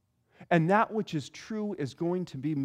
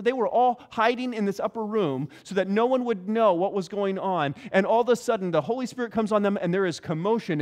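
ordinary talk, nothing marked

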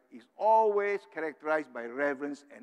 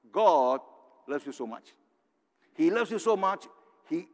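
usual speaking voice

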